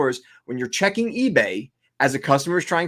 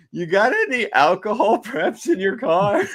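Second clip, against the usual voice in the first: laughing quote; 6 semitones higher than usual. Retold or imitated. Retelling line to Dave.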